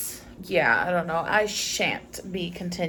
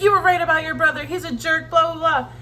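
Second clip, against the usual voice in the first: retold upset rant, higher and quick; mock upset; 6 semitones higher, 4 dB louder than usual. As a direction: imitate an upset friend venting, pitched up. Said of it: "upset visibly".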